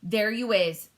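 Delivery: loud triumphant shout; giant's cry. Acted. BFG overhearing the phone call, loud triumph. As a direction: call out in vindication. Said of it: "cried the B F G".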